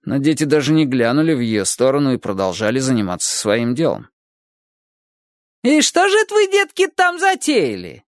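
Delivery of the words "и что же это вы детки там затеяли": put-on high shrill-free old neighbour calling out, much higher; sweetly calling over the fence, high old lady voice; friendly call. A narrator's reading. Neighbour calls to the children over the fence, high friendly call. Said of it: "приветливо крикнула она".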